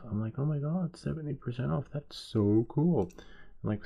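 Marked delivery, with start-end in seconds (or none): 0.4-3.1 delighted. Retold or imitated. Acts delight at big discount.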